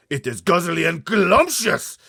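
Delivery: high, very loud, slow shout; gleeful shout. Acted. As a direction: shout your love of the taste. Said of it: "shouted the blood bottler".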